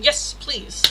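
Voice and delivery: ordinary talk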